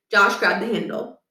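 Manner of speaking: ordinary delivery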